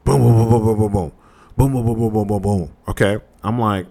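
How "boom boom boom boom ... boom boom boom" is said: rapid-fire vocal booms; imitated door banging. Storyteller imitating banging on the door at night, rapid booms. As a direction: mimic a fist pounding on a door. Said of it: "I hear banging on the door".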